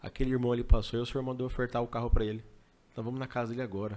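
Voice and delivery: fast, urgent rush of words; hurried. Retold or imitated. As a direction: rush to explain to your wife. Explica à esposa com pressa. (explicando à esposa às pressas)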